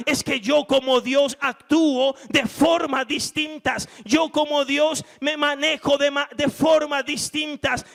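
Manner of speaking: loud, high emphatic reply; insist, God does it his own way; God answering Joshua. Acted. God correcting Joshua's doubts, loud emphatic preaching.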